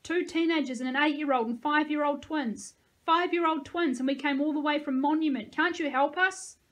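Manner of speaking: loud, high desperate outburst; insistent shout building in pitch; screamed. A narrator's reading. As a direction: yell after him, pleading for help. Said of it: "I screamed after him".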